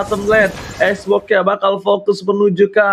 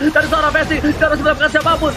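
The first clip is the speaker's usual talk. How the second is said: ordinary talk, nothing marked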